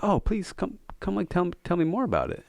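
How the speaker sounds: put-on old man warmly coaxing the drunk to talk; kind old man.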